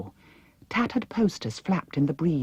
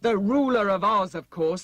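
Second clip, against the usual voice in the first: impatient; 6 semitones higher than usual. A narrator's reading. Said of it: "said the Wheeler impatiently".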